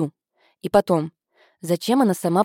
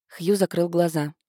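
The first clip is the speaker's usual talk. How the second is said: plain reading voice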